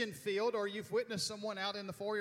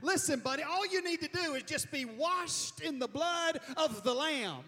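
higher-pitched, insistent church talk; hammed-up religious urging; mock church exhortation; 7 semitones higher than usual. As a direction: press it on a buddy like a pushy believer. Mimics churchgoer urging an outsider.

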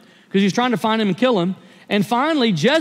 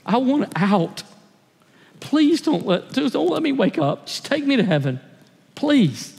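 raised, tearful plea to God; pleading prayer; 5 semitones higher than usual. Retold or imitated. Husband at his breaking point begging God, tearful pleading. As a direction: beg God to take him, voice lifting. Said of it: "I just began to beg God".

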